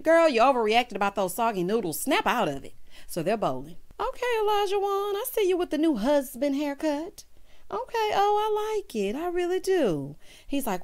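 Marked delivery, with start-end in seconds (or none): 4.0-10.2 mock girly voice. Voicing the cast, mock high.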